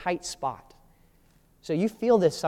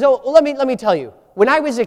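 bursting out higher and louder, eager to brag; a young shepherd jumping at the chance to boast; David cutting in keenly to tell his story; 8 semitones higher, 10 dB louder, faster than usual. Retold or imitated.

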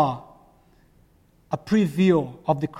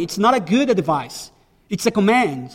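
ordinary talk, nothing marked

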